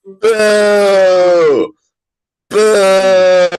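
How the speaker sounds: loud crowd boo; booing shout. Acted. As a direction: boo him loudly.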